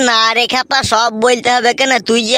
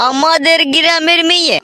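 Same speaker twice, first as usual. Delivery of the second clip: high, louder stunned question; shocked disbelief; 7 semitones higher, 3 dB louder than usual. Acted. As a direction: echo it back in shock. Uncle shocked by the news.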